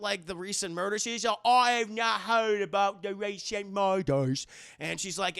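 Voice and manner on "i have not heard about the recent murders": loud, slow, exaggerated British accent; mock British accent. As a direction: put on a hammed-up British voice, stretching the words. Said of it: "starts talking with a British accent".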